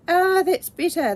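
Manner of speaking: relieved cry. A narrator's reading. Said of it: "they blinked".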